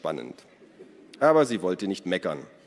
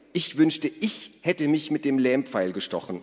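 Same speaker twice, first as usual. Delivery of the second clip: higher, mildly sulky grumble; grumbling; 6 semitones higher, 3 dB louder than usual. A narrator's reading. Naru muddy and teased by Ada, grumbling. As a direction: grumble it with raised pitch. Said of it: "murrte Naru".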